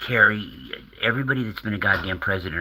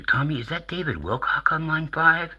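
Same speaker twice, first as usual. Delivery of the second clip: much higher, swooping mock radio host; George Norrie; 10 semitones higher than usual. Radio host asking his producer about a caller, mock high-pitched host voice. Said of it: "I hear George Norrie".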